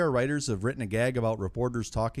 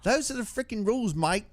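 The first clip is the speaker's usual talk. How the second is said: put-on high-pitched, botched New Zealand accent; dodgy Kiwi accent impression; attempted New Zealand accent; 10 semitones higher than usual. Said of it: "in a New Zealand accent".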